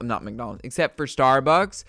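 normal talking voice

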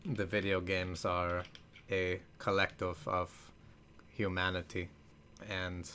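put-on low, slow, soft German-accented narration; ponderous attempt at a German accent, low and quiet; Werner Herzog. Podcaster failing at a celebrity accent, low, slow attempted German accent. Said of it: "I wish I could do".

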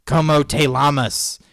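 loud, slow non-native Spanish accent; bad Spanish accent. Shown as a demonstration. Showing how not to speak Spanish, clumsy accented Spanish. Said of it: "I wasn't like".

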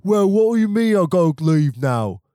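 a guest who won't leave, played up high and loud; indignant Brit. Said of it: "It's not like".

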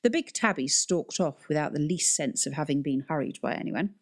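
plain reading voice

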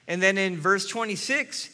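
ordinary delivery

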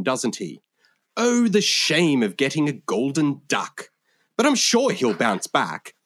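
genteel, swooping English cricket commiseration; English accent. Acted. Translator rendering cricket slang politely, English accent. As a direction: lament it in very proper English tones. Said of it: "speaking in my genuine English accent".

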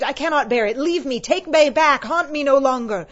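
loud, high, desperate pleading; anguished shouted plea; exclaimed. A narrator's reading. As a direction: cry out in torment, building. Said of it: "Scrooge exclaimed".

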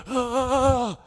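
high gasp, then a high cry of ah; sharp gasp then cry. Retold or imitated. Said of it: "started going like".

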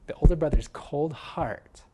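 ordinary talk

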